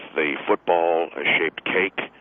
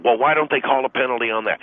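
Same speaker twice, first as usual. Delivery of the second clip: higher, louder mock wife; mock wife question; 7 semitones higher, 4 dB louder than usual. Husband mimics his wife asking about penalties, pitched up. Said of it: "That's what they do".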